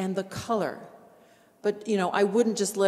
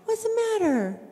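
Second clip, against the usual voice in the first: gentle comforting; 5 semitones higher, 4 dB louder than usual. Retold or imitated. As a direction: coax a crying friend gently. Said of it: "sitting at her feet going".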